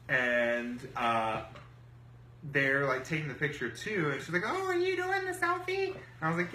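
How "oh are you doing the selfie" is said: very high-pitched mocking imitation; mimicked rival woman. Rival asking the storyteller about the selfie, high mimicking voice. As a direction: mimic her in a much higher voice.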